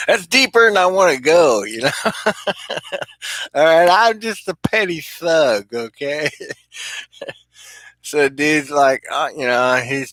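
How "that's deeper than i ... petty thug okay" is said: laughing. Retold or imitated. Laughing at his own fear.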